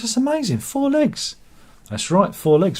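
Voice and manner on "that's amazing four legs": high-pitched, astonished joke character; amazed customer. Acted. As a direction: marvel at it in a much higher voice.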